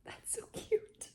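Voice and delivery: whispered, slow; swooning whisper. Real, not acted. Reader swooning at his words, whispering. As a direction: breathe it out softly.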